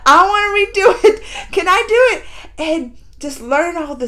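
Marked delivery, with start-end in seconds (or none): 0.1-2.3 louder, laughing, begging to do it; laughing eager plea. Real, not acted. Viewer pleading to redo the series herself, laughing and eager. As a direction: beg with a laugh.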